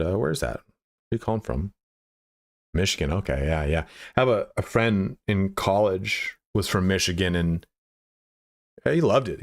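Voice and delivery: imitating a mentor's calm, unhurried sales call; easygoing small talk on a sales call; lightly voiced relaxed phone salesman; slow-cadence boss. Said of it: "kind of slow cadence the way he would deliver his sales calls".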